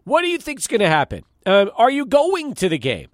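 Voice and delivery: usual speaking voice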